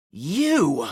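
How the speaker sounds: sharp startled cry; cried out. A narrator's reading. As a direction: shout it in shock. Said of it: "the woman cried out".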